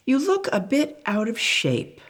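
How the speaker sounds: gently note the bent spoon; parent voice. Acted.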